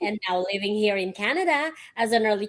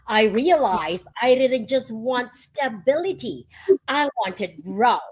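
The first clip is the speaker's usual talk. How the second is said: usual speaking voice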